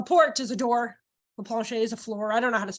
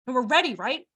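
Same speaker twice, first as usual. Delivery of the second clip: normal talking voice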